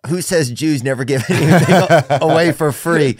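reading the joke comment through laughter; laughing while quoting. Retold or imitated. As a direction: giggle through the quoted comment. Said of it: "one of the first comments was".

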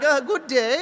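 high, loud mock German accent; German Willy Wonka. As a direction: play the theatrical German waiter. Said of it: "like, a German Willy Wonka".

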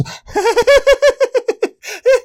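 high eerie giggling cackle, imitating the child; creepy cackle. Imitating his possessed-seeming daughter's creepy cackle. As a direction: let out a thin creepy cackle. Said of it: "really creepy cackling".

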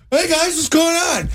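put-on oblivious revived kid. Storyteller voices the knocked-out boy waking, cheerfully oblivious. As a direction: imitate someone popping up clueless. Acting the boy popping right up.

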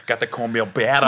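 low rapper voice. Acted. Comic showing co-host the rapper, low put-on voice. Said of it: "An E forty point oh".

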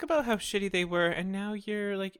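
high, aggrieved complaint; mock indignation. Acted complaint to coworker turned supervisor.

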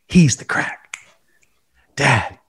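hushed whisper. Acted. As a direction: breathe the name in secret. Repeated show name.

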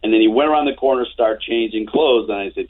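usual speaking voice

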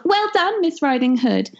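very high pig cheer; cheering piggy. Acted. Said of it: "stood and yelled".

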